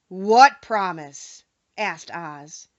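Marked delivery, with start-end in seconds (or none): 0.1-1.4 very slow, louder demanding question; stern Oz. A narrator's reading. Hidden wizard challenging the travelers, stern. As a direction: make them answer for it.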